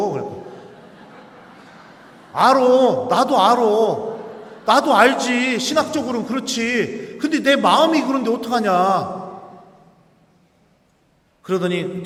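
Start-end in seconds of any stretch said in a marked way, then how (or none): 2.3-9.2 high, loud, pleading cry of conscience; anguished loud protest. Retold or imitated. Priest protesting to his classmate, loud and anguished. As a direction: blurt it out as if the heart overrules theology.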